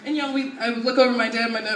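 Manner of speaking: ordinary talk, nothing marked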